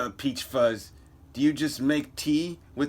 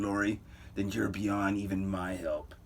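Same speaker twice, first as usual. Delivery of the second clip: plain reading voice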